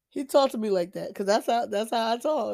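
ordinary delivery

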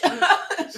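squealing high laugh. Real, not acted. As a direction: burst out laughing. Speaker laughs at her own story.

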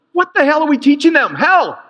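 real high-pitched indignant outburst; worked up. Real, not acted. Preacher faults how youth were taught, worked up. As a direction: cry out, genuinely worked up. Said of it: "Get a little worked up about that".

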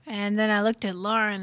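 ordinary delivery

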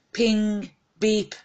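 high imitated ping and beep; phone ping. A narrator's reading. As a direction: mimic the phone alert sounds. Phone notification noises imitated.

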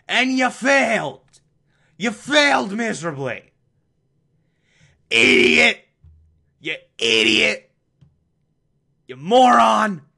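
high, loud insults hurled at the butler; mock berating. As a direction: scold him like a furious boss. Berating Alfred as an idiot.

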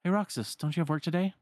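put-on high, girlish voice, gently surprised; a girl finding a gift by her pillow; curious Shion. Shion asking Roxas about the seashells, high and curious.

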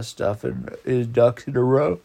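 ordinary talk, nothing marked

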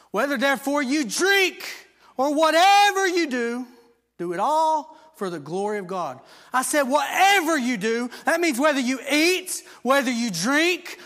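loud, high, ringing proclamation; preaching at full force. Acted. Preacher to congregation, loud ringing proclamation.

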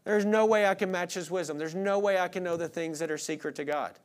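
ordinary talk, nothing marked

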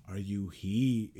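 low, slow grammar correction. Retold or imitated. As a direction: correct him with slow prissy care. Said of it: "And he goes".

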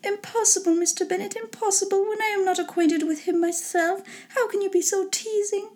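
high, soft wifely protest; high-pitched, understated exasperation; exasperated mother. A narrator's reading. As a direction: protest as a flustered wife being teased.

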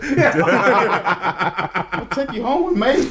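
slow high southern belle, laughing; southern accent. Pretend. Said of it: "Put on a little southern accent".

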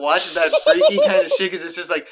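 a friend laughing while admitting horror is too scary. Real, not acted. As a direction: laugh off your own fear. Admitting horror scares them.

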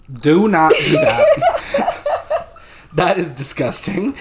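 high, loud, laughing disgust. Real, not acted. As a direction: squeal it out while cracking up. Reacting to her own story.

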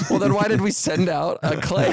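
can't keep a straight face asking it; laughing mid-quote. Retold or imitated. Mocking quote, laughing through it.

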